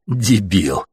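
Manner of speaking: ordinary delivery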